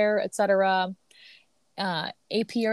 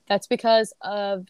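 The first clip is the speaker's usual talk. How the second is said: ordinary delivery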